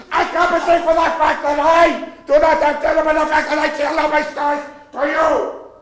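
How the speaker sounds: loud, high mock-German tirade; yelled tantrum in a German accent; ranting shout. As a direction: erupt into a hammed-up dictator rant. Mother Goose ranting, repeats line louder.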